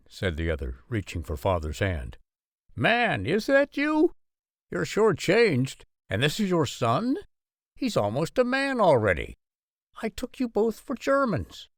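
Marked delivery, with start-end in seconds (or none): 2.7-11.7 excited farmer voice. A narrator's reading. Farmer greets old friend.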